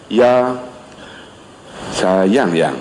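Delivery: tender, drawn-out low endearment; low, gentle voice. Shown as a demonstration. Husband at home addressing his wife, low gentle voice. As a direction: coo it low to your wife. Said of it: "saya memang rendah suara".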